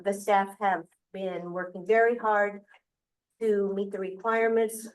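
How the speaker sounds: usual speaking voice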